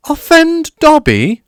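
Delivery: very high, loud choked cry; high tearful squeak; choked elf. A narrator's reading. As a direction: choke it out in tears. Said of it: "choked the elf".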